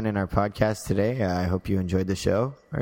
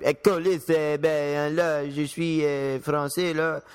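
higher-pitched Québécois French; broad Quebec French, pitched up; Quebec French accent; 5 semitones higher, slower than usual. Shown as a demonstration. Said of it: "well, Quebec French".

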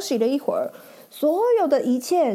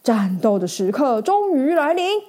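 ordinary delivery

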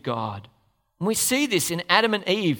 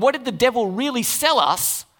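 normal talking voice

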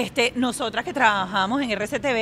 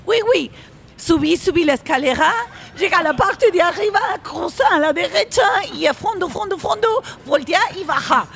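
high, sing-song mock French accent; Spanish in a hammed-up French accent; fake French accent; 6 semitones higher than usual. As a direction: imitate him passing off Spanish as French. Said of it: "empieza a hablar francés con ellos".

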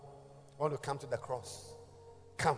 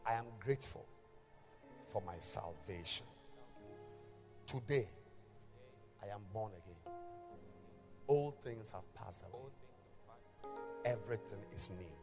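guided prayer; 2 semitones lower, 8 dB quieter than usual. Acted. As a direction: lead the prayer gently, line by line. Leads the sinner's prayer.

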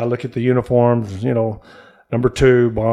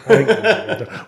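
laughing quote; 9 semitones higher, 5 dB louder, faster than usual. Retold or imitated. Teammate refusing to return, laughing.

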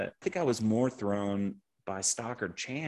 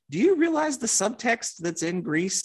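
high, loud incredulous question; exasperated, asking loudly and high; 6 semitones higher, 5 dB louder than usual. Retold or imitated. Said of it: "And I'm like".

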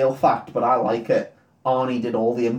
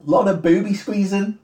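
higher repeat; 7 semitones higher than usual. Shown as a demonstration. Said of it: "repeated for effect".

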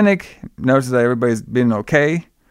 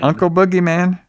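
put-on raised, light little-girl voice for the line; imitating a little girl; 6 semitones higher than usual. Retelling the movie, the girl calling her uncle. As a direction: play a child coaxing her uncle. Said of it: "She's like".

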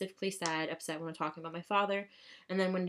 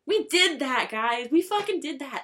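loud, high victory cheer; jubilant, emphatic boast; celebrating; 7 semitones higher, 7 dB louder than usual. Acted. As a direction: hype up the crowd, punching each "did". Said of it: "I'm like, "Yeah, bitch".

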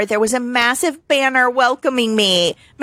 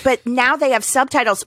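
ordinary talk

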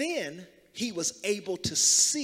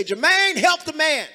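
high, loud holler over club noise; club shout; 9 semitones higher, 10 dB louder than usual. Shown as a demonstration. Preacher coaching congregation to shout for Jermaine, club holler. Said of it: "Say it like you out in the club".

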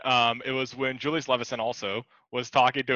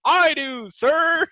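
high, loud, proud reply; eager pilot; 6 dB louder, much slower than usual. Retold or imitated. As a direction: answer like the hero pilot. Quoting pilot from movie.

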